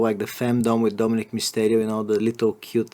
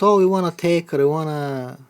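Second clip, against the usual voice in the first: mocking fan voice; 5 semitones higher, 3 dB louder than usual. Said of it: "some jokes about".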